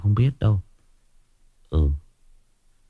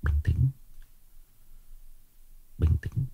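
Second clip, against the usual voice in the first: low and hushed self-soothing; calming himself; 5 semitones lower, 13 dB quieter than usual. Real, not acted. Streamer telling himself to stay calm after an outburst, hushed. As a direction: drop right down, soft and low.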